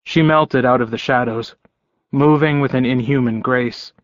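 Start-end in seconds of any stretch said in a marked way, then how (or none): none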